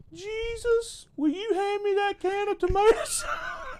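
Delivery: put-on high, loud, childlike plea to Jesus; scared boy calling out. Johnny in the joke calling into the dark pantry, high pleading shout. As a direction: yell it like a frightened little boy at a dark pantry.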